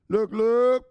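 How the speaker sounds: loud, high "look, look"; pumped-up shout; hyped catchphrase. Real, not acted. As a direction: shout it out like a hype man.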